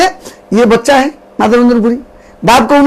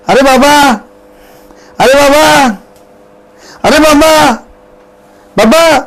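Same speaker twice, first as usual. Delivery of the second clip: a hint of a boy calling out, higher; lightly voiced cowherd boy; 4 semitones higher, slower than usual. Cowherd boy calling the saint, lightly voiced child. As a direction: call to the old saint like a village boy.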